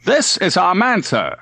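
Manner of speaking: eager admiral introducing his captain brightly. A narrator's reading. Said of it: "Leitner began brightly".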